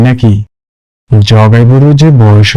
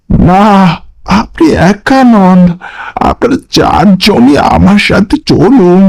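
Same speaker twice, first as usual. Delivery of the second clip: much higher, uncanny ghostly voice; eerie stranger; 8 semitones higher, slower than usual. Acted. Mysterious stranger greets the friends at the pyre, high and uncanny. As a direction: sound sly and otherworldly. Stranger insists all come.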